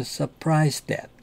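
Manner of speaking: surprised that linked, only one t sounded; one T dropped. Shown as a demonstration. Teacher shows surprised that with a single T. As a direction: let the t of surprised merge into that. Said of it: "하나를 발음 안 하는 거죠".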